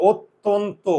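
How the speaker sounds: "অত্যন্ত" slowly, ot-ton-to with rounded vowels; pronunciation demo. Shown as a demonstration. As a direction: draw out "অত্যন্ত" without opening the jaw. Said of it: "মুখের চোয়াল খুলে নাই". ("অত্যন্ত" ধীরে, চোয়াল না খুলে)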